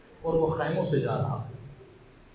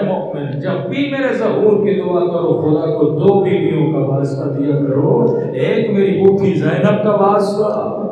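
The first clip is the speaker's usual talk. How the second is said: impassioned majlis preaching; 9 dB louder than usual. Acted. Preacher rousing the majlis about Husain's choice, at full force. Preacher's loud slow closing plea.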